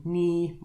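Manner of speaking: "ni" held high and level; first-tone "ni", high and steady. Shown as a demonstration. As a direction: say "ni" on a high, even pitch. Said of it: "we could have the first tone".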